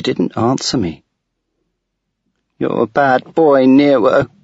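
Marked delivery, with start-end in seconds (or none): 2.6-4.3 put-on a child's voice, higher and louder, scolding; a little girl cross with her dog; angry little girl. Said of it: "she said angrily".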